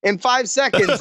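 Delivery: high-pitched, playful panic; mock freak-out. Guest teased about a heart attack, mock freak-out. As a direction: act worked up, voice pitched well up.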